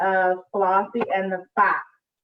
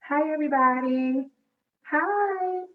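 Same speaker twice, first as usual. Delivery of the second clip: ordinary delivery, nothing marked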